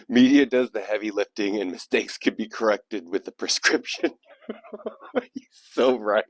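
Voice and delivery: amused reading that breaks into giggles; real laughing. Real, not acted. Host tickled by the poet's point, laughing as he agrees. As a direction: read on, barely holding back a laugh.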